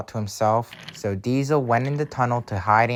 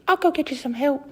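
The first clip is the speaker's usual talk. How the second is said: very high-pitched little engine voice; squeaky, high reassurance; Lady; faster than usual. Acted. Engine comforting crashed Toby, very high voice. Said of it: "cried Lady".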